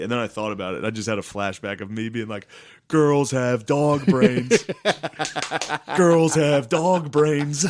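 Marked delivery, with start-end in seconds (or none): 2.9-7.7 loud, slow, higher taunting chant; drawn-out loud jeer; mock stage rant. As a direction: hammer it out slowly at the crowd. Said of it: "I kept saying".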